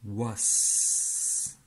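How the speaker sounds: "Was" with a very long hissed s; "Was" said as wass, ending held; voiceless ending. Shown as a demonstration. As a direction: stretch the final s of "Was". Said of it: "chante was".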